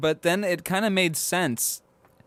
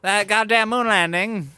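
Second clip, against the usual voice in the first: put-on mid-Atlantic accent; 7 semitones higher, 5 dB louder than usual. Continues the accent bit.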